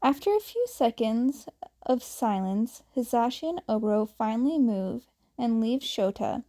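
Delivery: ordinary delivery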